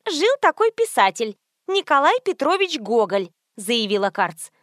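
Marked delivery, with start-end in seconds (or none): 0.1-3.3 higher-pitched know-it-all friend; self-assured socialite. A narrator's reading. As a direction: announce it confidently in a raised pitch. Socialite lectures philologist friend.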